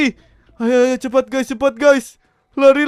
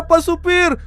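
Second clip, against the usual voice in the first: usual speaking voice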